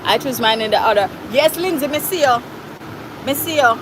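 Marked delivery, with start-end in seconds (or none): none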